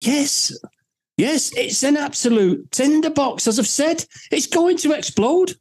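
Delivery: high-pitched agitated outburst. Real, not acted. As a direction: voice pitched right up in exasperation. Heated rant about the crisis.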